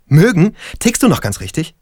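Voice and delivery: a theatrical scoff at the word Mögen; mock shock. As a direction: ham up the indignant disbelief. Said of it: "Lennart tut schockiert".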